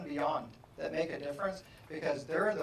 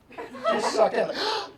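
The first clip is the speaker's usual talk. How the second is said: mock gasp; 7 dB louder, much slower than usual. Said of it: "goes like this".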